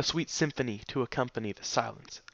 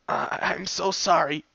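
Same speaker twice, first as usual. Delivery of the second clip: higher, cracking with tears; voice breaking; 6 semitones higher, 4 dB louder than usual. A narrator's reading. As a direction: let the apology crack. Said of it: "Dash's voice broke again".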